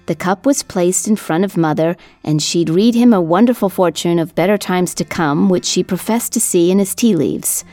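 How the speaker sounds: usual speaking voice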